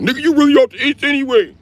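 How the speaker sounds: high, loud mocking voice of the neighborhood guys; high mocking challenge. Guys from the block challenging him on where he's from, high and loud. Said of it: "They be like".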